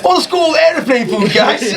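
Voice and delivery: high, laughing comic delivery; jokey high voice through laughter; mock stand-up patter. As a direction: pitch it up like a hack comic, cracking up. Parodies carrying on with the act.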